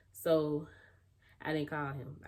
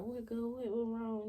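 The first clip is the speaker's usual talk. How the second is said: high-pitched, urgent questioning; mock alarm in a high voice; retold alarmed friend; 8 semitones higher, slower than usual. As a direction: imitate a friend demanding answers, pitched up. Retold worried friend answering calls.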